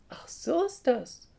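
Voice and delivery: high, loud happy realisation; light up with joy; delighted. A narrator's reading. Little Easter hare learns who he is, delighted. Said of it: "freut sich der kleine Osterhase".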